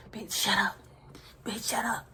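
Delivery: hushed retold line; whispered. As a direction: whisper it like overheard. Overheard brother through the wall.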